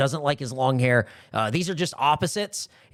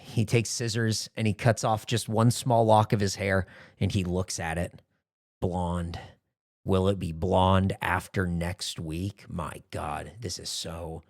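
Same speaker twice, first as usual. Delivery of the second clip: hushed dramatic narration; 5 semitones lower, slower than usual. Pretend. Narrator setting a dark backstage vignette, hushed and slow. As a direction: narrate the dark scene with grave mock suspense.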